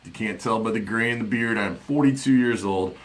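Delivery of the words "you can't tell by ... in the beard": ordinary talk, nothing marked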